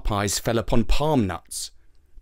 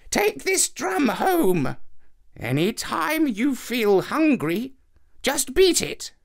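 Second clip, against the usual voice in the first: put-on higher, slow old woman; old woman's voice; 11 semitones higher, slower than usual. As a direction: hand over the gift like an old woman. Old woman gives the drum.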